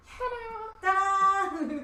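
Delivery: high, triumphant sung ta-da; gymnast's ta-da. Acted. Game master mimics a gymnast landing, triumphant ta-da. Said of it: "do one of those gymnastics".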